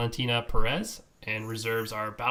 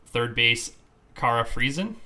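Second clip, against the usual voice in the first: ordinary talk, nothing marked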